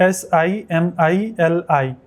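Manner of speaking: slow letter by letter spelling; spelling slowly. Shown as a demonstration. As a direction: spell it out one letter at a time. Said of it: "इसको कैसे पढ़ना है". (अक्षर अक्षर धीरे बोलना)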